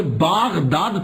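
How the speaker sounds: باغداد said very slowly with a long aa; original pronunciation. Shown as a demonstration. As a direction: stretch باغداد, long الف after ب. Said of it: "الف گر گیا". (باغداد آہستہ، لمبی آواز میں)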